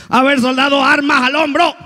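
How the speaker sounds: high barked army commands; drill sergeant. Acted. Sergeant barking orders at soldiers.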